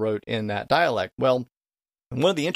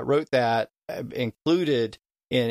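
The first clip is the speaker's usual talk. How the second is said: normal talking voice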